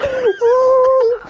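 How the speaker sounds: high, drawn-out vocal creak; creaking noise. Retold or imitated. Storyteller imitates the cannon creaking across the planet, long creak. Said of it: "You can hear this creaking".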